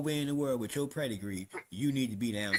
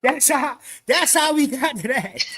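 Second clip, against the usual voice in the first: much louder and higher, real outburst; loud exclamation; 9 semitones higher, 13 dB louder, slower than usual. Real, not acted. Storyteller hitting the punch of how they got there, loud. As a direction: belt it out high, drawing the words out. Excited peak of his story.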